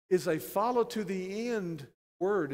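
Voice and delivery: ordinary talk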